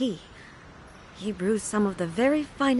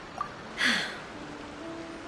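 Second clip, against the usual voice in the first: weary sigh; 9 dB quieter than usual. Acted. Saddened by fallen warriors.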